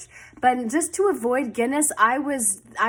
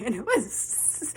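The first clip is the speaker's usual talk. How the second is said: drawn-out, laughing disbelief; slow it down through laughter, rising high; 9 semitones higher, slower than usual. Real, not acted. Storyteller recalling the old men's unsettling song, laughing.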